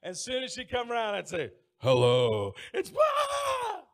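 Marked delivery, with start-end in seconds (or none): 1.8-3.8 spring out and shout to scare her; scary jump-out. Retold or imitated.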